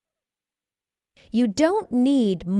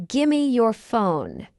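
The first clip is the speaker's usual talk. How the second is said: slow, measured "Gimme your phone"; slow model. Shown as a demonstration. Teacher models the phrase for learners first, slowly. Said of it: "fast English is not difficult".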